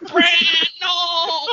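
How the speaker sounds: loud high pleading cry. Pretend. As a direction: beg him to stop. Acted pleading scene.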